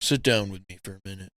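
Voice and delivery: a hint of a low gruff Bowser; mock Bowser. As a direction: invite him over like a brooding boss. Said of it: "Bowser's like".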